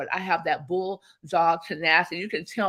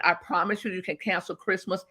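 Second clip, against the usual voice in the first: ordinary talk, nothing marked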